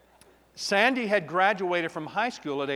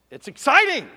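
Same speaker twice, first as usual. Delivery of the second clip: high and loud burst; excited; 11 semitones higher, 11 dB louder than usual. Real, not acted. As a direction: let it burst out, thrilled. Emotional person describing his feelings.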